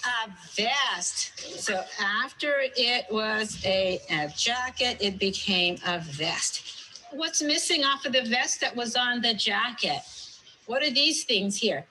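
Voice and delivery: lively read-aloud quizzing. Acted. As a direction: draw the answers out of the kids. Teacher quizzing the class.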